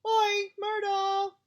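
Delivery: long, high, loud bellow across the room. A narrator's reading. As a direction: call her out at full lungs.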